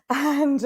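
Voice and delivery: a sudden loud, high laugh breaking into the talk; real burst of laughter. Real, not acted. Laughing at herself for never being a crier. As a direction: laugh out at yourself, then carry on.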